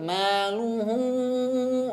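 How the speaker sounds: very slow maluhu with no nasal sound; clean recitation. Shown as a demonstration. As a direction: draw maluhu out slowly, nothing through the nose. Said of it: "Jangan sampai ada suara hidung". (maluhu pelan tanpa suara hidung)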